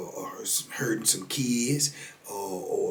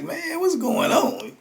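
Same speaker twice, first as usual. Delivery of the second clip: way higher, slow, stretched out in disbelief; baffled inner voice; slower than usual. Retold or imitated. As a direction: let the confusion pull the pitch up high. Narrator's own puzzled thought.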